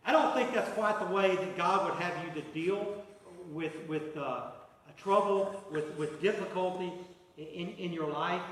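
loud, rising preacher's push; preaching at full force. Acted. Preacher warning the congregation, loud and pressing. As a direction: drive it home from the pulpit.

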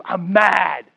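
ordinary delivery

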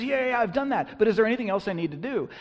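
high, loud, hurried reply; eager young man. Acted. Voicing rich young man answering Jesus.

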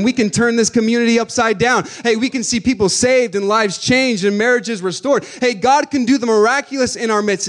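rising pulpit fervour; preaching at full force. Acted. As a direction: preach it to the back row.

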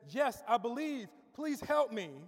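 loud, high acted pleading; urgent plea. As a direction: cry out desperately as the cliff-hanger. Cliff man pleads once more.

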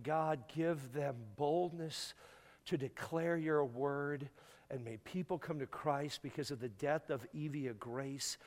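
a lightly voiced hospital-room prayer, unhurried. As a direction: pray it slowly and gently. Leads the retold prayer.

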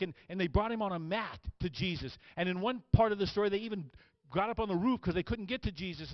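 high, energetic sermon storytelling; fired-up preaching. Acted. Preacher telling of the paralytic's friends, impassioned preaching. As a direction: tell the story with rising fervor. Preacher retells at full force.

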